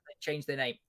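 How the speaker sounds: normal talking voice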